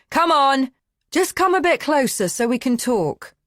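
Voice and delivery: high, loud coaxing call; shouting. A narrator's reading. Man calling the woman back to talk, loud coaxing. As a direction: call her back, loud but pleading. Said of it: "He shouts again".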